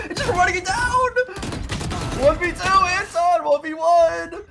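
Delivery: high, loud, laughing-crying hype; hyped yelling. Real, not acted. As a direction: scream it through the clutch. Caster hyped during clutch.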